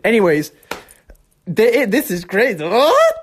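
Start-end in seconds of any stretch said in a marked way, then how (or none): 1.5-3.2 high, excited outburst; geeked-out amazement. Real, not acted. Geeking out, then apologises for it.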